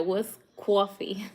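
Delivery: drawn-out New York "coffee", amused; mock New York accent. Streamer quoting a viewer who teases her accent, mock New York. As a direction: slowly play up the New York vowel in coffee. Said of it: "you making fun of my accent".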